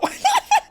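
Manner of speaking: loud, high laughing burst; real high-pitched laugh; big laugh. Real, not acted. Cracking up at retold insult.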